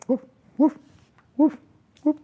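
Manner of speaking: high playful woof at a dog; imitated barking.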